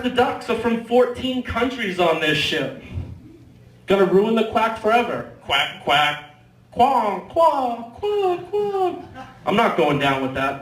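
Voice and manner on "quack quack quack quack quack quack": duck quacks climbing higher. Pretend. Comic mocking the tour guide, quacks climbing up into a squeak.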